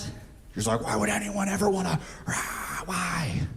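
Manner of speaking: soft, hushed bewilderment; mock baffled hush. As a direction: murmur it, baffled.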